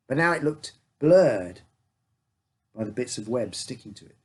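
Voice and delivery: ordinary delivery, nothing marked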